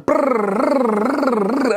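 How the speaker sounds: fast rolled lip trill like notes; rolled brrr. Shown as a demonstration. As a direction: trill it like rapid guitar notes. Imitating rapid picked notes.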